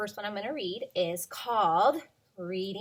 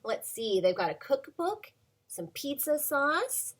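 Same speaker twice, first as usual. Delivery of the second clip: usual speaking voice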